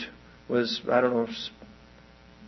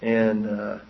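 normal talking voice

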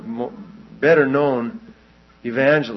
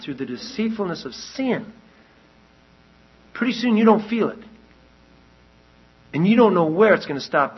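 higher, louder, driving sermon voice; fiery preaching; 6 semitones higher, 5 dB louder than usual. Real, not acted. Preacher warning the congregation about hardened hearts, fiery preaching. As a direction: preach it hard to the pews. Preaching builds to full force.

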